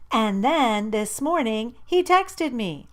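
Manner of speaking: giddy, high and loud; excited. A narrator's reading. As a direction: build up to the big news. Gushing about boy's text.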